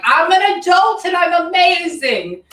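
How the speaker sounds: high, loud, drawn-out mock bragging; cocky teen boast. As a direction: crow it like a know-it-all eighteen-year-old. Acting out a cocky eighteen-year-old.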